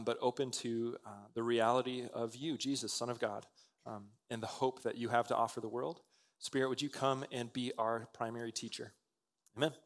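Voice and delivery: low, soft praying voice; hushed, earnest pastoral prayer. Real, not acted. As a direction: pray gently, dropping the pitch. Leads a prayer.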